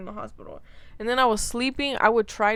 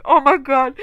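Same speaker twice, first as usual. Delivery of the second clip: excited; 5 semitones higher, 11 dB louder than usual. Retold or imitated. Finally given a sandwich.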